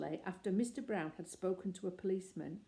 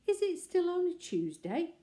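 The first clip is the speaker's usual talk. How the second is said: higher, louder wide-eyed little bear; innocent question; 11 semitones higher, 8 dB louder than usual. A narrator's reading. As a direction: play dumb as sweetly as you can. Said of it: "asked Paddington innocently".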